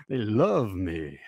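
slow it down and savour the brag; mock proud plumber. Coach mocking a truck-loving plumber, low slow smug drawl.

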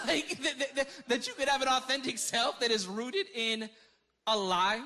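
loud, high disbelief; incredulous. Real, not acted. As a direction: laugh in disbelief, then push it out loudly. Incredulous at an authentic self being false.

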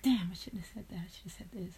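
whispered inner voice scolding himself; self-critical whisper. Retold or imitated. Whispering to himself after watching his own video. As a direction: hush it like a private regret.

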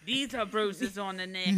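slow, laughing mock Australian; joking Australian accent, slowed down. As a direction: drag it out in a hammed-up Aussie voice. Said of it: "Why are you from Australia now".

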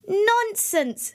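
high, brushing-off "Nonsense"; brisk dismissal. A narrator's reading. Wife covering for Jack to the ogre, brisk high dismissal. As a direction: wave his suspicion away lightly.

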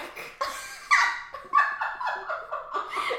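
high, loud burst of laughing; real long cackling laugh; laughter. Real, not acted. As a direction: crack up loudly at the joke. Laughing at own joke.

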